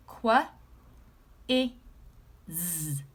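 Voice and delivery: Qu- i z said as separate sounds; sounding out. Shown as a demonstration. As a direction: sound out Qu- i z slowly, one sound at a time. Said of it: "Let's sound it out together".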